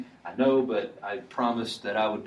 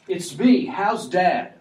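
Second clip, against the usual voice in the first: higher, louder "How's Dad?" burst out eagerly; eager Joseph; 7 semitones higher, 6 dB louder, slower than usual. Shown as a demonstration. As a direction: blurt "How's Dad?" as an excited brother. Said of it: "Immediately changing".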